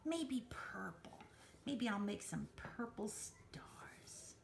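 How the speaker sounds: hushed, breathy murmur while coloring; soft whisper, slowing down, absorbed in the task; whispering to herself. Real, not acted. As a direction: drift into a private whisper as you draw. Quietly coloring designs to herself.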